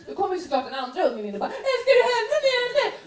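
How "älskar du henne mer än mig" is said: put-on much higher, fast, louder sobbing kid; jealous crying child. Jealous second kid crying to mom, high fast sob. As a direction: whine it like a jealous little sibling.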